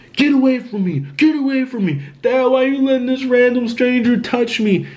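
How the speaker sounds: high-pitched imitation of frantic recoil; agitated high voice, retold without shouting; retold agitated brother. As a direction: act out warding someone off, voice way up. Possessed brother voiced, recoiling from touch.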